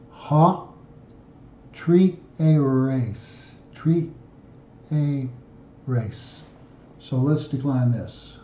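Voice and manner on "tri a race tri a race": "tri, a race" broken into slow separate syllables. Shown as a demonstration. Teaching the Greek word to students, sounding it out slowly. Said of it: "almost the same in Greek".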